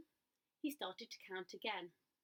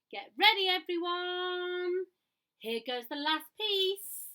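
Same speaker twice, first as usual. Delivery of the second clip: loud, high, slow drawn-out call; rally everyone before the throw; booming excited shout to the ducks; 9 semitones higher, 10 dB louder, slower than usual. A narrator's reading. Gumboot calling out excitedly.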